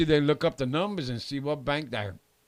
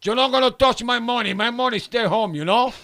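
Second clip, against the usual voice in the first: put-on raised voice of a stubborn old man; proudly guarding his cash, higher; grandfather voice; 7 semitones higher, 3 dB louder than usual. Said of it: "My grandfather used to put his money".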